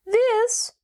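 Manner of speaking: high squeaky creature voice; tiny squeak from a huge beast; squeaky gump voice. A narrator's reading. Newly awakened gump marvelling at itself, squeaky voice. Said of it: "in a squeaky voice not at all proportioned".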